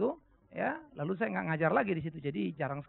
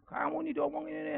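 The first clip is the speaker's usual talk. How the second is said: retold angry father; 9 semitones higher than usual. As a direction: imitate an exasperated dad scolding. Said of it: "Bapaknya marah". (menirukan bapak marah)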